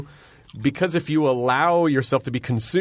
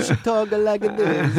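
higher, slow mock Italian accent; imitating Italian great-grandmother; 6 semitones higher, slower than usual. Shown as a demonstration. Host showing how great-grandmother talks, hammed-up Italian accent. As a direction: demonstrate a thick comic Italian accent. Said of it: "I'm imitating my great-grandmother".

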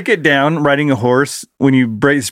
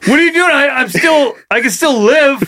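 pleading horse; 9 semitones higher than usual. Retold or imitated. The horse pleads after breaking its leg.

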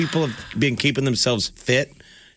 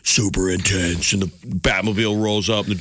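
super intense; 3 semitones lower than usual. Shown as a demonstration. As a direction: drop low and draw it out, super intense. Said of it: "build it up super intense".

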